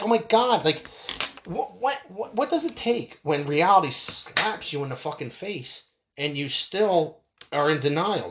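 fed-up cry of disbelief at people in denial; exasperated disbelief. Retold or imitated. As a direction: throw up your hands at their denial. Exasperated retold reaction.

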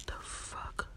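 whispered under the breath. Real, not acted. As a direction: whisper it to yourself. Muttering at a cracked phone.

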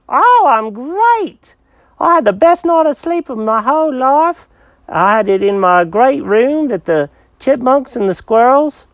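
put-on high-pitched, delighted cat with an accent; pleased-as-punch little cat; cheerful cat Jenkins. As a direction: gush happily in a high character voice.

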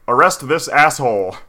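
loud barked order, pitch up; hammed-up guard yelling arrest; mock shout. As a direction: yell it like an angry guard.

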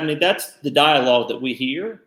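ordinary talk

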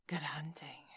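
a grim hushed send-off; grim whisper. A narrator's reading. Snake parting from the panther, grim whisper.

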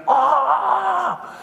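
high, drawn-out comic wail; mock pained cry. Kicked bully doubles up and falls over, mock pained cry. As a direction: imitate a boy crying out in pain. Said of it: "crying in pain".

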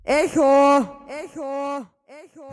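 loud call fading away each time; cave echo. Retold or imitated. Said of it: "Der hört sich nämlich circa so an".

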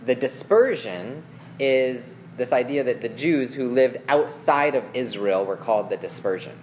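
usual speaking voice

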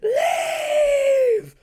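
very high, loud drawn-out mock scream; retold scream. Retelling the figure who yanked mom from bed, shrieked command. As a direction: scream it like an attacker in her ear. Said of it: "just screams in her ear".

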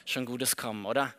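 low and quiet, unimpressed; dismissive. Retold or imitated. Nathanael dampening Philippus's joy, low and dismissive. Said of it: "ist so eine Abfuhr".

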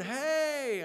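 high, mock yell of hey; calling out high to the lost group; retold yell. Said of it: "I yelled as loud as I could".

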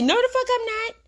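playful high defiance; high emphatic refusal. Retold or imitated. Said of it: "she finna say".

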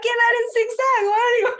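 high, laughing retelling; giggling at her own fear; laughing through words. Real, not acted. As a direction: laugh it out while telling.

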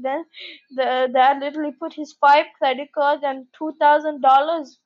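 ordinary delivery

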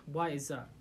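ordinary talk

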